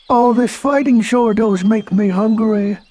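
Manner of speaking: high cheerful cartoon bear; Pooh voice. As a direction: play Pooh, delighted and hopeful.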